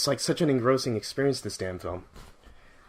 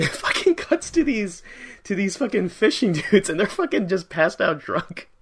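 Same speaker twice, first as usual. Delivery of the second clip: tickled, higher-pitched storytelling with chuckles; amused, laughing; 6 semitones higher than usual. Real, not acted. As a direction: laugh through the words. Amused retelling of film.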